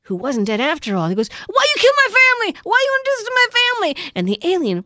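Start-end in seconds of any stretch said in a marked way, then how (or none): 1.5-4.1 angry yell. Retold or imitated. Hero yells at alien.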